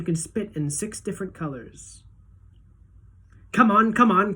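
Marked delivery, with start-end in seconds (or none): 3.5-4.3 loud and high, urging them on; eager cry. A narrator's reading. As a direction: call out impatiently. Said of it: "cried Mister Wonka".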